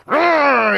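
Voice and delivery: high loud comic roar; mock roar. Reader voicing Kuma's furious roar, high and loud. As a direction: roar like an angry beast.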